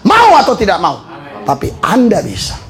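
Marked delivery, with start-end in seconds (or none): 0.0-1.0 preaching shout. Real, not acted. Preacher urging listeners they can become shepherds, shouting. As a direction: belt it out to rouse the room.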